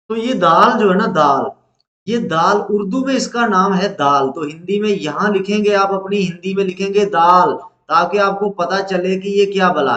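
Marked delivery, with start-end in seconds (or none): none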